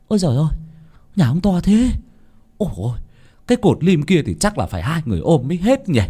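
high, gaping wonder; awed villager. A narrator's reading. Laborers marveling at the landlord's big house, high and amazed.

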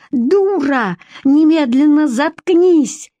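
furious, high, loud yell. Acted. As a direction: scream at her to shut up. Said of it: "Вика орала так громко".

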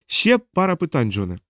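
high-pitched, controlling woman; impatient interrogator. Acted. Diana stalls Hermund and questions John, high and firm.

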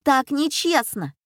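put-on high-pitched complaint of a girl; indignant. A narrator's reading. Cheated Катя to the bandits, indignant protest. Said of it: "возмутилась Катя".

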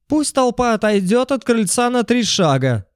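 high, squealing man calling out; squeaky man's voice. A narrator's reading. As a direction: shout it like a nervous official. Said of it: "чуть визгливый мужской голос".

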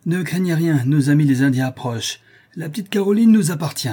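high, loud exhortation; rally accomplices, rising to a shout; triumphant rallying cry. A narrator's reading. Rebel rallies his accomplices. (cri de ralliement triomphant)

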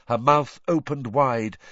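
ordinary delivery, nothing marked

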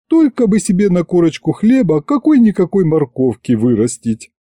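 high-pitched, hard-done-by lament; plaintive aunt. Acted. Aunt protesting she has no unearned income, plaintive. Aunt pleads poverty to dodge paying.

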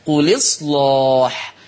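"قل إصلاح" linked as quliṣlāḥ, heavy dark l, slow; نقل مع تغليظ اللام. Shown as a demonstration. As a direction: slowly drop the hamza in "قل إصلاح", thick l before the long a. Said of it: "تغليظ اللام". ("قل إصلاح" بلام مكسورة دون همزة، ولام مغلظة، ببطء)

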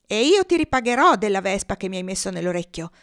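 ordinary delivery, nothing marked

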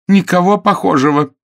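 high, loud protest; emphatic denial. A narrator's reading. Clara denying she knows the man, loudly emphatic.

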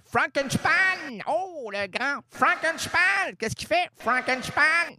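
high, slow showman call; grand announcer. Pretend. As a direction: announce him like a circus star. (annonceur de cirque)

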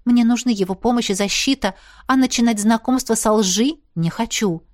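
usual speaking voice

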